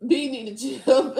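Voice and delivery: loud words breaking into laughter; real helpless cackling delivery; crying with laughter. Real, not acted. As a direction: burst out laughing as you speak. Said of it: "I'm fucking crying".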